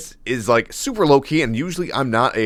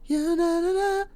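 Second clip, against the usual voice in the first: falsetto demo; 4 dB quieter than usual. Shown as a demonstration. Explaining vocal range, he demos a high falsetto. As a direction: sing ya na na na in a thin falsetto way above his voice. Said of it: "'Cause it's not, like".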